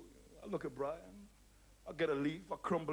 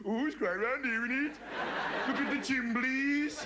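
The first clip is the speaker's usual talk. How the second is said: high, slow Brummie with a laugh; Birmingham accent; 7 semitones higher, 4 dB louder, slower than usual. Retold or imitated. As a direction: savour the Brummie vowels. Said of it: "I'm going".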